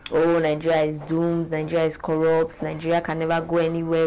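ordinary delivery

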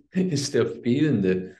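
slow, laughing restart after an interruption; amused laugh. Real, not acted. Lacht nach Störung, setzt neu an. (lachend neu ansetzen, höher und gedehnt)

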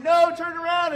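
high frantic yelled warning; retold coach scream. Preacher retelling the coach yelling at his runner. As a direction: yell it like a panicked coach. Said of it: "his coach was screaming".

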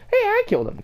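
high, delighted yelp; a real burst of surprised delight; excited squeal. Real, not acted. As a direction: squeal with joy at the kill. Excited at a kill.

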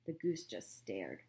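usual speaking voice

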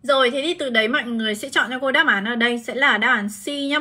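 usual speaking voice

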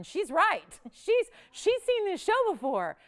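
high, loud, laughing concession; amused exclamation. Real, not acted. Host conceding to a savvy regular caller, amused exclamation.

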